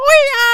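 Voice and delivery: ওইয়া thrown up very high and loud as "oi-aa"; a high, loud swoop on ওইয়া; exaggerated drill pronunciation. Shown as a demonstration. Teacher drilling a spelling for the class, ওইয়া high and loud.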